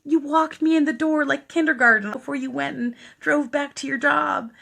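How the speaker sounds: high, loud coaxing plea; imitated pleading. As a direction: plead like a kid at the kindergarten door. Dying man pleads with her.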